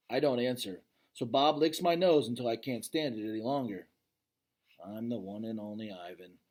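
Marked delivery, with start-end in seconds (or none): 4.8-6.4 low, soft grudging mutter. A narrator's reading. Gorilla giving in to Bob's demand, muttering softly. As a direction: say it under your breath, reluctant. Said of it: "I mutter".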